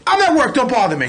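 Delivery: loud, high burst of annoyance; retold brush-off, starting with a yell; irritated outburst. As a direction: snap it loudly into the phone. Retold snapping on the phone.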